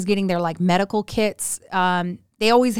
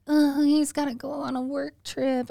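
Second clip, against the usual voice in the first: high, soft self-pitying whine; mock complaining; 6 semitones higher, 4 dB quieter than usual. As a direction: imitate a wife moaning about trivia. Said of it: "women that are like".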